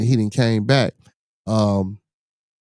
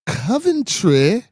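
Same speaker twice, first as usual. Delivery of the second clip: high, slow, exaggerated English accent; 11 semitones higher, much slower than usual. Shown as a demonstration. Showing the chat an English accent, high and drawn out. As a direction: stretch it into a hammed-up English accent. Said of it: "that's my English accent".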